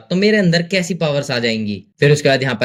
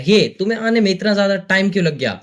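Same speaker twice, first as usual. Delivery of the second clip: usual speaking voice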